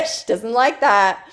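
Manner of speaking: slow, laughing repeat. Real, not acted. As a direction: say it again through a laugh. Repeats remark while laughing.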